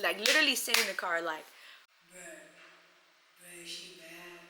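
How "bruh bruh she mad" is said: very quiet, low and slow; hushed confiding. Retold or imitated. Him confiding in her in the car, hushed. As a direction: imitate a guy confessing under his breath.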